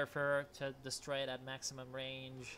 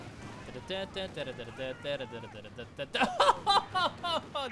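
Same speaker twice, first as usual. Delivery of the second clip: rapid laughter; 6 dB louder than usual. Real, not acted. Laughs at double wipe.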